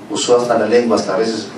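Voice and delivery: ordinary talk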